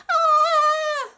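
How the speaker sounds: high, loud panicked shriek; high terrified squeal; goblin scream. Acted. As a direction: shriek at every word. Prince keeps screaming.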